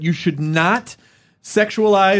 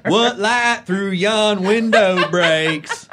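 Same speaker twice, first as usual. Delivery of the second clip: slow, higher Southern-twanged Shakespeare line; 5 semitones higher, much slower than usual. Acted. Mocking a drama student's Shakespeare in a thick Southern twang. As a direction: drawl out the verse in a broad Southern twang. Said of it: "trying to do Shakespeare".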